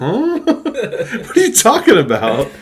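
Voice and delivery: high, slow laughing confusion; laughing disbelief. Retold or imitated. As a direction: ask it while cracking up. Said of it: "And I was like".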